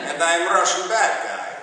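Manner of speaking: put-on hammy Russian movie accent. Actor plugging a film as the Russian bad guy, Russian accent.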